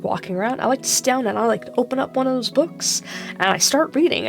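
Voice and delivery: usual speaking voice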